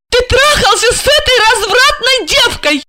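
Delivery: high-pitched, tearful accusation. Acted. Wife confronts husband over his mistress, tearful. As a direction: accuse him through tears, pitched high. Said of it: "В её голосе появились слёзы".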